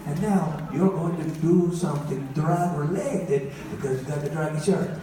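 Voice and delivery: hammed-up Italian mother giving advice; exasperated mock-Italian mama; bad Italian accent. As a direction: scold and lecture in a comic Italian accent. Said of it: "My mom always talks in a bad Italian accent".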